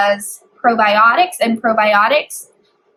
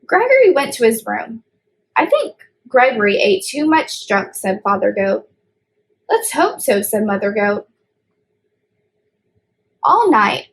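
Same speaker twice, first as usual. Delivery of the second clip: put-on reading a picture book aloud to kids; storybook reading. A narrator's reading. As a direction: read it like story time for little ones. Child reads storybook aloud.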